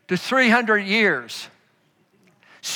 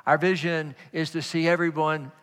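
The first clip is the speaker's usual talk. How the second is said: soft preaching; 4 semitones lower, 3 dB quieter than usual. Acted. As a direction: draw them in with a low hush. Quiet intimate close of sermon.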